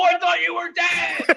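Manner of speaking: high, drawn-out yell; exasperated shout. Retold or imitated. Club owner to friend found alive in bed, exasperated shout. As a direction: wail it slowly in overblown disbelief.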